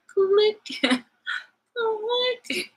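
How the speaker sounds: high-pitched, squeaky little 'click'; mock dismissive click. Telling gossipers she won't listen further, mock high click. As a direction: pop it out high and cartoonish.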